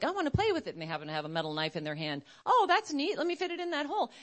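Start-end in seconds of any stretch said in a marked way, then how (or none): 0.0-0.7 put-on high little-kid whine; mock child begging to play; child's voice. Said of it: "the child's going over and they're like". 2.4-4.1 put-on high, curious little kid; mock child's innocent delight. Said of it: "the child's going over and they're like".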